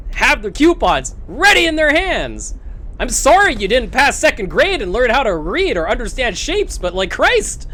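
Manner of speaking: indignant rant. Real, not acted. Venting rant about rude customer.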